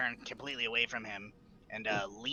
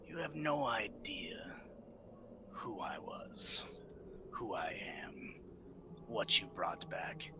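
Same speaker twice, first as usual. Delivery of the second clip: slow, soft, lower whispered threat; menacing whisper; 3 semitones lower, 9 dB quieter, much slower than usual. Acted. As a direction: let each phrase hang darkly.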